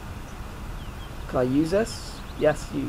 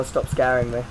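usual speaking voice

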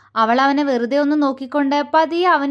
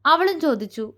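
ordinary delivery

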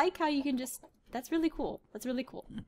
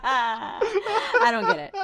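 laughing; 11 semitones higher, much slower than usual. Real, not acted. Laughing at the quote.

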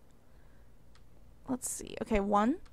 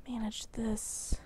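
whisper; 2 semitones lower, 11 dB quieter, slower than usual. Real, not acted. Risky move, muttered to self.

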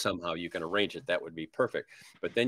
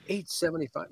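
surprised; 8 semitones higher than usual. Retold or imitated. Seller shocked by price.

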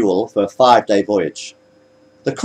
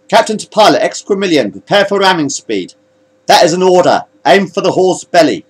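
higher, louder command to the pilot; urgent captain's order; 7 semitones higher, 4 dB louder than usual. Acted. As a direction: insist on being obeyed at once. Captain orders a ramming attack.

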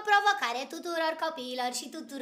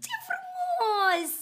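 much higher, very slow, drawn-out coo; awed gush; touched and delighted; 13 semitones higher, 4 dB louder, much slower than usual. Real, not acted. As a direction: melt at the sweet ending. Moved by the cat story.

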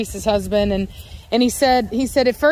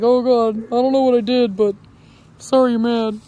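a hint of an apologetic puppy, a little louder; lightly voiced meek puppy saying sorry; voicing the meek puppy; 3 dB louder than usual. Owner voicing the bullied puppy giving up, mildly apologetic. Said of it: "He's like".

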